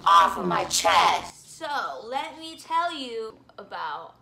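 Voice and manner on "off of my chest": low, slow, loud put-on voice; mock deep voice. Vlogger echoing herself in a silly low slow voice. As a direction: drop into a growly joke voice for "Off of my chest".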